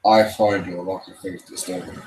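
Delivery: a hint of the menacing Sith lord; Darth Vader impression. Streamer admits his Vader is weak. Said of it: "My Darth Vader impression is not that good".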